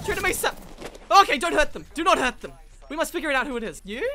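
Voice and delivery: loud, high urgent commands; frantic orders. Real, not acted. Player orders team not to hurt them, frantic shouting. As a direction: shout orders over the fight.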